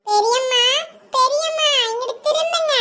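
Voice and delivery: put-on high child's call for attention. Child trying to get the aunt to turn round, calling out.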